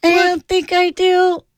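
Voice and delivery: very high, slow falsetto attempt. Shown as a demonstration. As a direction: try out a falsetto, drawing it out. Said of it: "You don't have a falsetto?".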